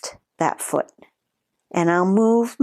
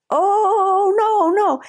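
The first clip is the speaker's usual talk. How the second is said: 높고 크게 터지는 다급한 목소리로; 턱이 안 닫혀 당황한 비명처럼; panicked oh no; 13 semitones higher, 4 dB louder, much slower than usual. Retold or imitated. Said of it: "And I was like".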